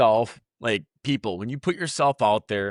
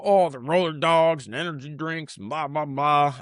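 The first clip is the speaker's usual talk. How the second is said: put-on crappy Southern accent; 3 semitones higher than usual. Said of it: "I did a Southern crappy accent".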